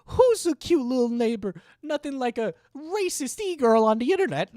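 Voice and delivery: very high squeaky cooing; squeaky baby talk. Pretend. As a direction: squeal it like fussing over a puppy.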